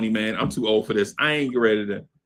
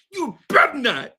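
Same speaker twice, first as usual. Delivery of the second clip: stern warning; 9 semitones higher than usual. Retold or imitated. Warning his father not to cry.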